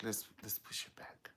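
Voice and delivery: unvoiced whisper, dropped right down. [name] backing out of the wedding, whispered. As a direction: hush it like a nervous aside.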